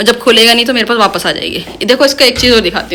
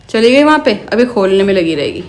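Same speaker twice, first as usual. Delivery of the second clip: ordinary talk, nothing marked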